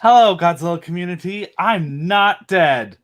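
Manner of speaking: playful announcer-style hello; big hello. Real, not acted. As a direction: burst in with a grand greeting. Guest's grand entrance greeting.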